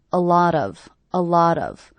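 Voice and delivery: "A lot of" run together, stress on "lot"; linked as one unit. Shown as a demonstration. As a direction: link "A lot of" into one word. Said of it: "these three words as one unit".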